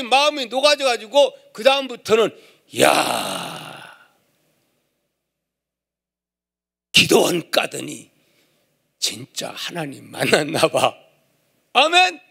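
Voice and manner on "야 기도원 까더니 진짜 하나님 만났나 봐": lightly voiced amazed husband.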